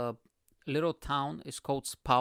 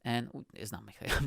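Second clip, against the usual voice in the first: ordinary talk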